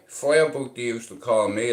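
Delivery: normal talking voice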